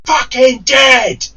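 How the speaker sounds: slow, high, drawn-out shout; furious yell. Retold or imitated. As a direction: bellow the threat up at a block of flats. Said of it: "I yell out her name".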